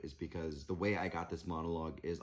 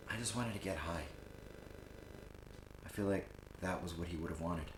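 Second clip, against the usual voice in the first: soft, halting admission; understated acted grief; subdued confession; 8 dB quieter than usual. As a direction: confess it quietly after a long pause.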